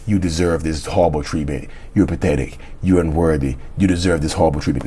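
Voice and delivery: low repeated inner voice; inner voice mantra. Retold or imitated. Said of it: "That's the only voice you hear".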